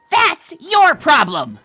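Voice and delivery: loud, slow, emphatic comeback; angry retort. Acted. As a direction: raise it and hit each word. Quarrel retort.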